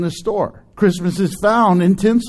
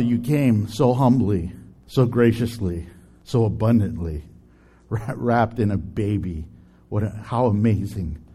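prayer; 8 semitones lower than usual. Acted. Leads an opening prayer.

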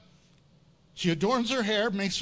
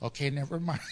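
deflated mom; 6 semitones lower, 6 dB quieter, faster than usual. Retold or imitated. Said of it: "she looked at me and goes".

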